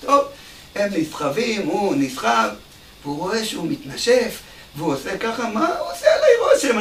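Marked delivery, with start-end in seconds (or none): 5.5-6.8 high, laughing scoff; a scornful laugh through the words; mock scoff. Bellhop scoffing at the rich guest's panting, high and laughing.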